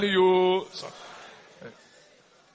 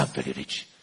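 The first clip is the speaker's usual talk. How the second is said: very low, softly spoken boast; boastful retold declaration; 13 dB quieter than usual. Preacher recalling how he used to talk, low quiet boast. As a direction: drop right down low and quiet. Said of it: "I used to talk like this".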